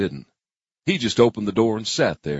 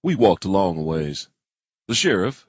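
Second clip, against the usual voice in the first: ordinary delivery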